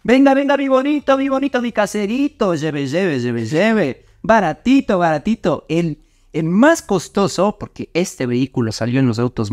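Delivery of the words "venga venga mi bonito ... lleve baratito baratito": hyped fairground barker. Acted. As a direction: shout the bargain like a street seller. Hawking the car like a vendor.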